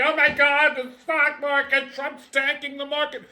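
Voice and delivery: a mocking impression of market panic; mock panicking investor. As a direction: parody a panicked crowd.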